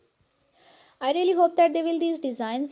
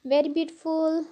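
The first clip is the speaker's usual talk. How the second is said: Pakistani English, warm vlog greeting; pakistani accent. Real, not acted. Whole vlog in own accent.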